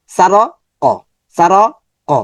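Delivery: সা, র, ক chopped apart with pauses; wrongly broken word. Shown as a demonstration. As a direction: break সারক into pieces, the wrong way. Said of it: "তাহলে কিন্তু এটা সৌন্দর্যটা নষ্ট হয়ে গিয়েছে". (ভুলভাবে ভেঙে পড়া)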